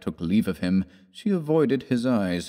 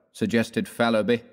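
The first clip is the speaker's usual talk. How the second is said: ordinary delivery, nothing marked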